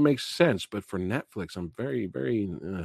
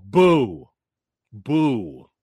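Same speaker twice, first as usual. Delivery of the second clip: louder playful boos; mock booing; 4 semitones lower, 6 dB louder than usual. Still unhappy with the rating, loud mock boos. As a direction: give it one last heckle. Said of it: "y'all know what I'm booing".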